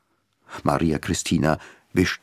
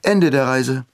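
announcing; 7 semitones higher, 4 dB louder than usual. A narrator's reading. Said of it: "Maria Cristina verkündete".

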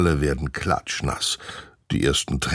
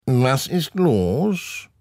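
high, drawn-out grumbling man's voice; a grumpy neighbour disturbed at his fence; 9 semitones higher, much slower than usual. A narrator's reading. Grumpy man at the fence, slow grumble. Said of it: "fragt er mürrisch".

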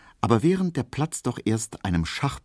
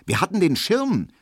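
astonished; 11 semitones higher than usual. Acted. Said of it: "fragt Frau Braunack erstaunt".

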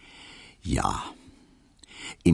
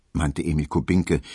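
plain reading voice

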